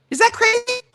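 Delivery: sudden high squeak of disbelief; can you believe it, high and incredulous. Real, not acted. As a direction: shoot way up in mock amazement. Exclaiming at inherited habits.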